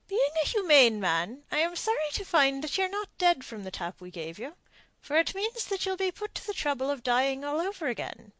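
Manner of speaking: dry gallows humour; sardonic captain. A narrator's reading. Blood tells the prisoner he must die, sardonic.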